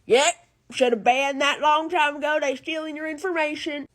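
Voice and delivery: a lightly voiced smug grown-up; imitating smug adults about the ban; mock adult. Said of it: "adults are like".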